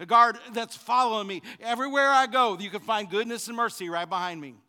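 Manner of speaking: loud, high, driving sermon voice; full-force pulpit preaching; fiery preaching. Acted. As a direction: preach it hard to the congregation.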